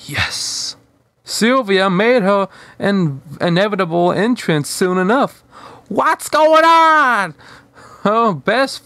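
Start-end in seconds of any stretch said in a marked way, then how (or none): 0.1-0.8 flat, hushed one-word reply; deadpan. Acted. Lord Hader answering Wanda's question, dry deadpan. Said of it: "Hader deadpanned". 5.9-7.4 much higher, slow, louder arrival call; Sylvia voice. Acted. Sylvia entering the room, loud high curious call.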